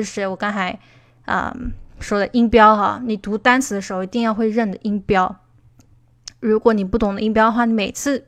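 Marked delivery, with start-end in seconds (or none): none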